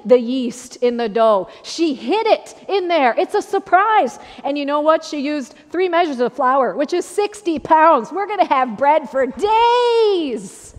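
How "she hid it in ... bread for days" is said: higher, building excitement to a long, loud finish; excited marvelling. Real, not acted. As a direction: marvel at it, stretching the last word out high. Preacher marvels at hidden yeast, huge batch.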